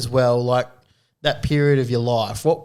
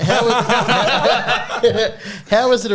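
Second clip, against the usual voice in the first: laughing; 10 semitones higher than usual. Real, not acted. Host still laughing.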